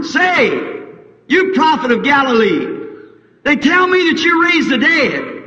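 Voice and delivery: a crowd member yells his challenge across the street; hollering crowd. Retold or imitated.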